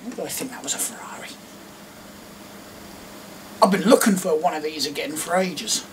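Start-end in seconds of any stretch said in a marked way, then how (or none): 0.0-1.3 self-mocking whisper. Real, not acted.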